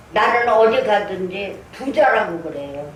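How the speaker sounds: ordinary talk, nothing marked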